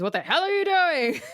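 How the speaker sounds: high-pitched mock outrage; imitated angry boss. Retelling the boss walking in on Edward, mock outrage. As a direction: do the boss catching someone red-handed. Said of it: "Just the way he says that".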